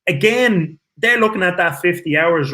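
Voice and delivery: normal talking voice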